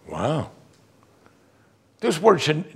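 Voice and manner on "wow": usual speaking voice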